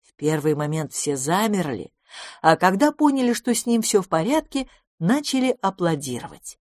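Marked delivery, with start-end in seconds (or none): none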